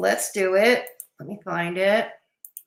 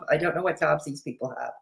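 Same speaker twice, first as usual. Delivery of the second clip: much softer, faster mutter; muttered aside; 4 semitones lower, 9 dB quieter, faster than usual. Shown as a demonstration. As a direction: mutter it to yourself.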